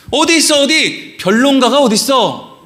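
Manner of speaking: urgent colloquial calling-out in short bursts; insistent searching demand. Shown as a demonstration. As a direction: challenge the debater to step forward. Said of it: "이런 식으로 읽는 거예요".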